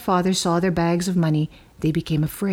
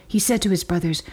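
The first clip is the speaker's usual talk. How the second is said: ordinary delivery, nothing marked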